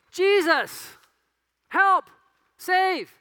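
high, loud cry of a plea; desperate sermon prayer cry; pleading prayer. Pretend. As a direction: cry out to Jesus for help.

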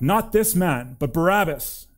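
mild narrated shout; shouted back. A narrator's reading. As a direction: call it back as a crowd demanding someone else. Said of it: "they shouted back".

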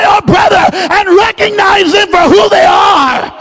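big rising preacher's cadence, pressing each point; full-force preaching. Acted. Preacher urging the congregation to see their brothers, full-force preaching. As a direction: drive it home like a pulpit climax.

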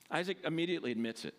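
normal talking voice

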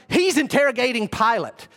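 ordinary delivery

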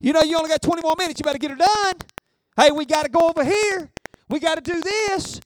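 the distractions calling you away to the game; hammed-up call to watch football; pushy game-day voices. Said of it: "you'll have all them voices".

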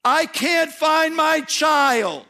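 high, slow, aching cry of a grieving parent; God crying out for a lost child; anguished plea. Acted. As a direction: plead like God searching for the lost. God's heart for lost people.

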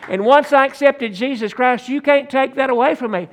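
rising preacher fervor. Acted. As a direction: raise the pulpit voice, pressing the point. Preaching to congregation.

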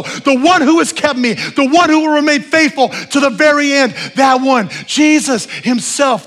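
loud, high exhorting preacher; preaching at full force. Acted. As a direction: drive it home to the whole church.

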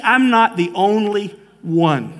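usual speaking voice

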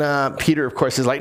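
ordinary delivery

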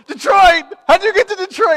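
high, loud disbelieving cry; shocked shout. Retold or imitated. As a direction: shriek it in total disbelief. Both shocked at the Detroit sign.

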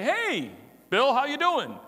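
high, loud hello to a caller; cheery phone greeting. Acted. Preacher acting out answering the phone, bright loud greeting. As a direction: greet Bill like an old friend.

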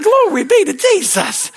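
high, loud mock hallelujah voice; showy put-on praise; mock pious praise. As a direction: imitate over-pious rejoicing, hammed up. Mocks pious response to frustration.

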